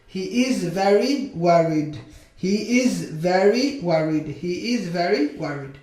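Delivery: slow, stretched-out model sentence; slow modelling. Shown as a demonstration. Teacher models a sentence for English learners, slowly. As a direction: draw the words out for learners.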